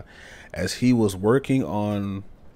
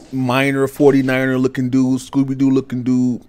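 loud excited; 4 semitones higher, 8 dB louder than usual. Real, not acted. Relieved after escaping monster.

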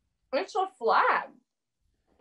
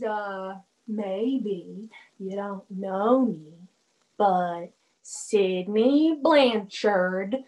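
tipsy drawl; 8 semitones lower, much slower than usual. Real, not acted. Drunk girl boasting slowly.

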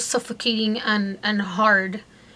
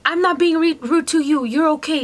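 imitated irritated doctor, pitched higher; raised voice; 6 semitones higher, 4 dB louder than usual. Doctor dismissing his patient's complaints, raised voice. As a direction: insist testily, voice raised. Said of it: "he raised his voice at me".

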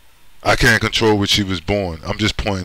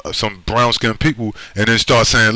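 ordinary delivery

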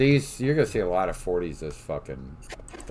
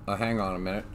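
ordinary talk, nothing marked

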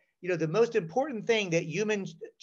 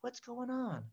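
gentle hushed repeat; mother whispering to calm her boys; soft whisper; 5 semitones higher, 12 dB quieter than usual. Retold or imitated. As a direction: coax them quietly. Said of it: "she whispered".

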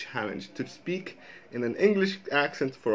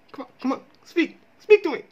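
high-pitched mock pleading; playful urging; 6 dB louder, faster than usual. Vlogger urging Irish people to talk, high playful pleading. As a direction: coax them in a squeaky high voice.

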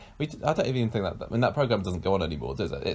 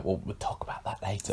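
hushed; 8 dB quieter than usual. Real, not acted. Hushing co-host.

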